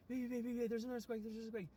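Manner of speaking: high-pitched urgent alarm, words repeated in a rush of fear; imitated panicky squeal; re-enacted panic. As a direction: act out waking a partner in fright. Re-enacting panic during an aftershock.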